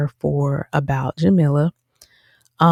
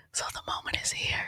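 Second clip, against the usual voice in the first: hushed suspense; 15 dB quieter, faster than usual. Real, not acted. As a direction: lean in and whisper the build-up.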